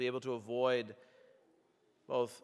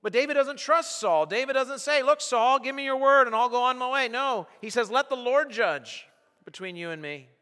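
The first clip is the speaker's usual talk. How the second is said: high, loud, driving sermon voice; full-force preaching; 10 semitones higher, 9 dB louder than usual. Acted. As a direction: preach it out to the whole hall. Preacher voicing David's trust.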